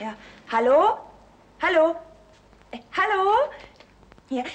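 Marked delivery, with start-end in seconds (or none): 0.5-3.3 immer höher und fragender rufen; rufend ins Telefon. Acted. Ruft ins Telefon, keiner antwortet. (Tochter ruft in den Hörer, keiner meldet sich, fragend lauter)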